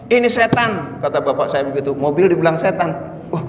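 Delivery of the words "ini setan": mock-angry father, pitched up; father's angry scolding. Father scolding son over new car, mock anger. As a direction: imitate his father scolding him about the car. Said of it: "Bapak saya marah-marah".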